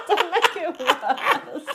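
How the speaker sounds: high, loud, slow laughing plea; real laughing protest. Real, not acted. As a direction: squeal it out through laughter.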